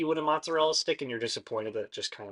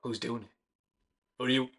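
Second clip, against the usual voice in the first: bartender voice; 6 dB louder than usual. Acted. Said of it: "And he sees Kiki and goes".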